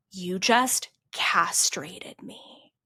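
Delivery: slow, hissed whisper of barely held fury; retold angry whisper. Coach's voice message, seething at a client in slow whispered anger. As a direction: whisper the accusation slowly, seething. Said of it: "I get an angry voice message back".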